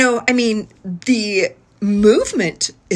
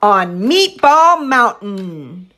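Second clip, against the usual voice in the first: slow, loud, stretched, stress on Meatball; slow modeled phrase; 5 dB louder, much slower than usual. Shown as a demonstration. Therapist modeling the phrase for the child to echo. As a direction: model "On Meatball Mountain" slowly with a rise on Meatball. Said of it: "I'm varying my prosody".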